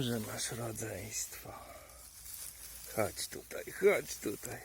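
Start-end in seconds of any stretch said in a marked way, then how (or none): 3.0-3.6 whispered coaxing; unvoiced whisper to the mushroom. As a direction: breathe it out like luring a pet.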